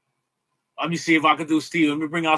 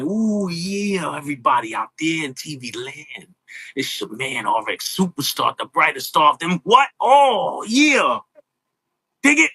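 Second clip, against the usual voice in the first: raspy, dragged-out promo growl; Macho Man impression. Doing the Macho Man promo for the host, raspy growl. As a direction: sell it like an intense wrestling promo. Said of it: "And then I do Macho Man".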